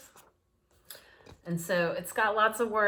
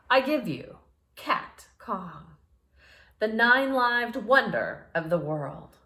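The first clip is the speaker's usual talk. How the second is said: grand announcer, a bit louder; showman announcement; 3 semitones higher, 6 dB louder than usual. Acted. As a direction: present it to the crowd. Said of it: "Ladies and gentlemen, he squeaked".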